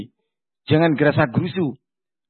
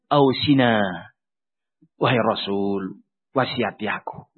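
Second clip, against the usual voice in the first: low, softer voice of a man begging for advice; pleading petitioner; 10 semitones lower, 3 dB quieter than usual. Acted. Man asking the Prophet for advice, low and pleading. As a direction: beg humbly for counsel.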